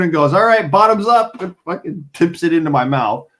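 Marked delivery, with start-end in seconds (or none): 0.3-1.4 high, chirpy bottoms up; cheerful no-nonsense mom; brisk mom. Retold or imitated. Mom tips ginger into son's mouth, chirpy high.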